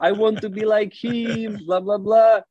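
high-pitched imitation of adoring young fans; mock fan squeal, kept high throughout; mock excited screaming. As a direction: gush in a high voice like a thrilled kid. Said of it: "they are screaming like".

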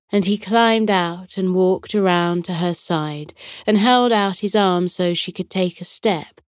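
ordinary delivery, nothing marked

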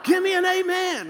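high, shouted preaching; child playing preacher. A boy preaching to stuffed animals, high and shouted. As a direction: holler at the dolls like a fiery preacher. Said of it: "I'd just start preaching".

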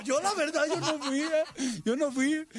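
high-pitched plea of innocence; mock panicked denial. Teller to the angry rival, mock pleading denial. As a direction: protest innocence in a hammed-up high voice.

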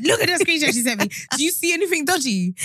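high and quick, pressing for an answer; excited sister. Retold or imitated. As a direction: rattle it off, eager to be told.

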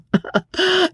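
very high silly fop's laugh; high giggling inane chuckle. Acted. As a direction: laugh like an empty-headed dandy. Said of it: "with an inane laugh".

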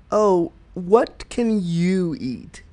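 slow "what can YOU eat"; imitated othering question, long weighted "you"; stress on you. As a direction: draw it out and lean on "you". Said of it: "how you ask that question".

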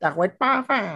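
very high put-on call; imitated joke voice. Co-host echoing the show-off rider, high put-on voice. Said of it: "แต่พี่[name]ทำเสียงนะ".

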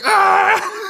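very high mock wail; imitated kids' scream. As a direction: squeal like screaming children.

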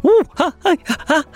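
high, loud ticklish laugh; tickled giggle. A narrator's reading. Girl tickled by monkeys, high ticklish laughter. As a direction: giggle while being tickled. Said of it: "as she was getting tickled".

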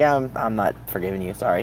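mock unforgiving aside; deadpan refusal. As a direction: toss off the refusal flatly. Own blunt refusal acted out.

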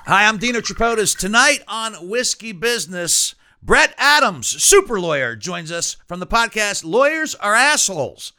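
loud, high show-opening announcer read; announcer intro. Acted. Host introducing tonight's guest to listeners, loud announcer read. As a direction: punch it out like a radio host.

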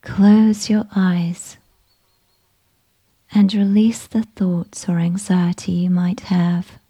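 ordinary delivery, nothing marked

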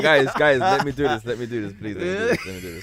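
high squeaky pleading through laughs; laughing. Real, not acted. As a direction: beg while cracking up.

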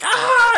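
sudden high yelled "Ah!" of a stabbed man; mock death cry. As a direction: yelp like you just got stabbed, hammed up. Acted stab victim crying out.

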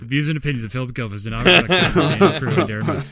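rapid, loud legal disclaimer with a laugh; mock disclaimer. Host disowns his co-host's remark, mock disclaimer. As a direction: rattle it off like the small print.

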